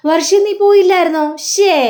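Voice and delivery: a startled man, voice jumping up high. Acted. Sreekanth startled to see Varsha.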